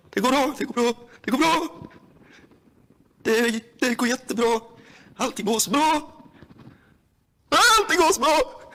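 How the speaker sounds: nervous pitch climbing toward a yelp; rising panic. Real, not acted. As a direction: talk yourself calm while panic rises. Panicked self-reassurance rising.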